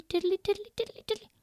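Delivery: high, light tiddly steps; high dainty tiptoe patter; tiptoe sounds. A narrator's reading. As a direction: sing out little tiptoeing steps. Tiptoeing upstairs, high tiny steps.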